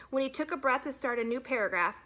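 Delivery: usual speaking voice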